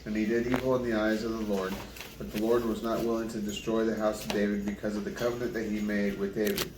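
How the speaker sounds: usual speaking voice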